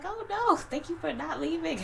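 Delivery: high, loud grateful gush; excited high voice. Retold or imitated. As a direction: squeal your thanks. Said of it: "I was like".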